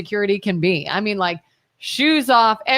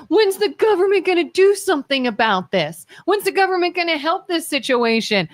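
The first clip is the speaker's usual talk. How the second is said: whiny complainer; 7 semitones higher than usual. Retold or imitated. Mocking public complaint voice.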